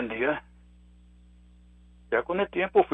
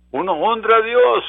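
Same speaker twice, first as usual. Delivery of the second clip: much higher and louder on Uno honra a Dios; emphatic preaching; 8 semitones higher, 8 dB louder than usual. Acted. Preacher pressing the point to his church, raised emphatic cry. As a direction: hammer Dios home to the congregation.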